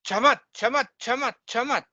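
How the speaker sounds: repeated "chamat" of wonder; amazed chamat. Shown as a demonstration. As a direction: exclaim "chamat" in awe. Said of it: "we say chamat".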